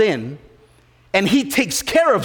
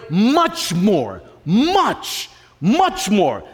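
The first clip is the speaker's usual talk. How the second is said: much more, building on the middle much; preacher hammering much more; stressed repetition; much slower than usual. Shown as a demonstration. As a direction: lean hard on much. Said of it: "can you even say that".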